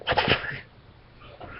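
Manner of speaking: slow breathy whisper of disbelief; whispered shock. Acted. Harry hears the imp's odd vision, whispered disbelief. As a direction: breathe it out, stunned. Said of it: "little Harry's like".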